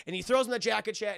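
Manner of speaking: usual speaking voice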